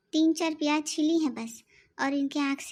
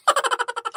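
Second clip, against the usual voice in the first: gloating laugh; 8 semitones higher, 5 dB louder than usual. Acted. Mocking laugh after scheming.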